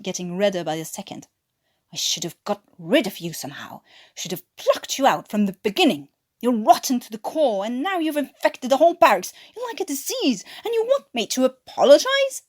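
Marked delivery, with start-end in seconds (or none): 1.9-12.4 furious shout. Acted. Watkins to Newkirk, rising to a high furious shout. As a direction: spit out every insult. Watkins, red-faced, explodes.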